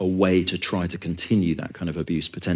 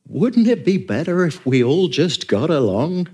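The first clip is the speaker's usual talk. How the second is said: higher, slow, hopeful voice of a naive churchgoer; wistful imagined listener; 8 semitones higher, 4 dB louder, slower than usual. Retold or imitated. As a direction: voice the listener's wishful thought, lifted and slowed. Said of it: "and you think".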